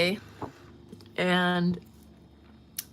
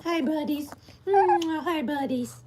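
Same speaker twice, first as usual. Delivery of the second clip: put-on higher sweet baby talk with a kiss; pet voice; 8 semitones higher than usual. Owner greeting her two dogs, high baby talk.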